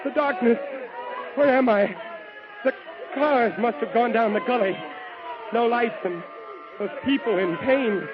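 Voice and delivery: high, tense, frightened inner voice; shaken and dazed. Acted. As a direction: piece together the wreck in shock. Dazed survivor of the wreck.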